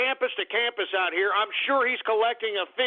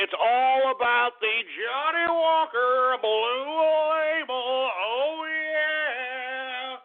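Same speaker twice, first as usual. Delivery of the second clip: savoring; 4 semitones higher, much slower than usual. Acted. Savoring his whisky.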